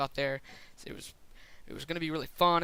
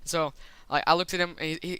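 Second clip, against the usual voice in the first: normal talking voice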